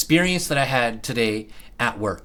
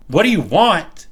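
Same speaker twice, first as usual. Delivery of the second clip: mock attitude, pushier; 4 semitones higher, 5 dB louder than usual. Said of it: "she looks over at me and she goes".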